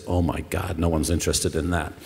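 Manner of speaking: put-on quiet, dismissive Canadian accent; a light imitation of a Canadian accent. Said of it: "that's my Canadian accent".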